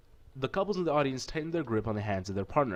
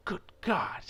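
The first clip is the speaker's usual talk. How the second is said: whispered; 8 semitones higher than usual. Real, not acted. Hurt foot, whispered.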